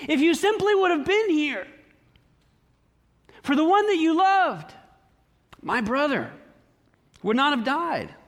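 high-pitched, mildly anguished plea; sad, confused. Acted. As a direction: plead in grief, falling away on the last words. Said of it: "She's sad. She's confused".

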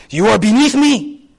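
put-on superior voice; Korean elder. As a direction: pull rank on a junior, mock-stern. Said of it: "I'll talk to someone in Korea, they're like".

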